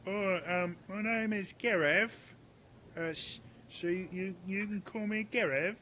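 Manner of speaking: high, hesitant stammer; high nervous young guard; timid Gareth. Acted. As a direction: stammer it out shyly. Gareth voiced by GM.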